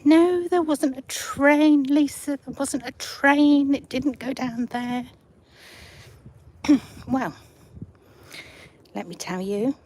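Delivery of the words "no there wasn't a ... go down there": loud, slow, incredulous denial; disbelieving doubter. Retold or imitated. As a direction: insist loudly it never happened. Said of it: "nobody ever believes me".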